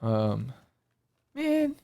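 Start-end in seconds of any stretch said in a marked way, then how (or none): 1.3-1.8 surprised. Real, not acted. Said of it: "but they called him Baba Yaga".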